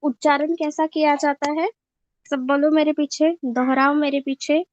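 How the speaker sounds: ordinary delivery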